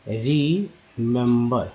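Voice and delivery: Remember stretched out slowly, every syllable clear; remember said very slowly and clearly; slow careful model. Shown as a demonstration. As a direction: drag remember out for learners to copy. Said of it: "बहुत सावधानी से समझेंगे।".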